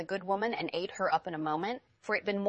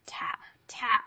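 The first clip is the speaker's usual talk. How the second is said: whispered narration; hushed, unvoiced knocking; 2 semitones higher than usual. A narrator's reading.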